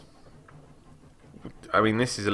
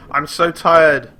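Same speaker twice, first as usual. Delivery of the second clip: loud, higher mock-exhausted moan; mock weariness; 11 semitones higher, 14 dB louder, much slower than usual. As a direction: ham up being tired. Mock tired line.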